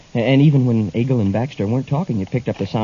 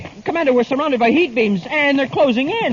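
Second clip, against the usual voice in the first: panic; 8 semitones higher than usual. Acted. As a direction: shout as the heat beams close in. Trapped by closing heat beams.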